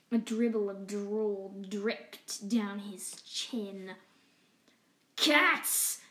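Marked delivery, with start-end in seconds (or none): 5.2-6.0 a touch of an ugly sneer, louder. Acted. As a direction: spit out the word with contempt. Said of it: "he said in an ugly voice".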